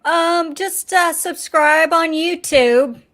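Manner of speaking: slow, drawn-out thick Southern accent; Southern accent, high and stretched out. Shown as a demonstration. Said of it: "you said that with a really thick Southern accent".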